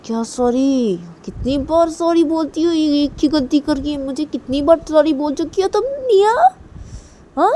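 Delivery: high, loud scolding; angry scolding. Acted. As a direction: scold her for repeating the mistake. Scolding the child. (ऊँची आवाज़ में गुस्से से डाँटना)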